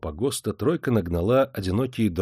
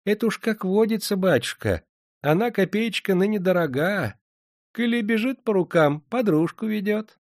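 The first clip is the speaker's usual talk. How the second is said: put-on high, sweet old woman voice; sly old moneylender; 11 semitones higher than usual. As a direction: coax him with honeyed old-crone talk. Moneylender's voice held.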